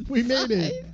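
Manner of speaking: high-pitched mock victory cry; mock triumph. Guests finally through the broken window, mock triumph. Acting guests who got inside.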